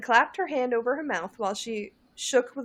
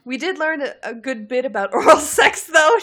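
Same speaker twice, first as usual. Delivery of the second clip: laughing; 4 semitones higher, 10 dB louder than usual. Acted. First read, rising, cracking up.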